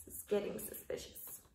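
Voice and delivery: whispered aside; conspiratorial whisper to the listener. Real, not acted. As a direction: lean in and whisper.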